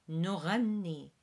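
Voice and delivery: نغني, uvular GH; throaty GH. Shown as a demonstration. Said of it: "which is a R".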